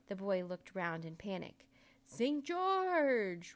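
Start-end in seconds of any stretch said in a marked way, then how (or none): 2.0-3.5 loud, high, drawn-out bellow; impatient roar. A narrator's reading. Magician calls again for the missing puppet, roaring impatiently. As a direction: roar the name as an overdue summons. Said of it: "roared the magician impatiently".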